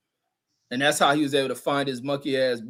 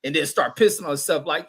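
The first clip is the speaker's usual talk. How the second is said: normal talking voice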